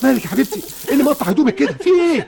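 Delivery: panicking over his hurt daughter; alarmed father. Acted. Father panicking as he sees his daughter's torn clothes, high and frantic.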